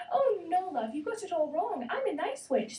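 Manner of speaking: a lightly voiced kind witch; kindly. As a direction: reassure the girl gently. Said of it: "said Wendy kindly".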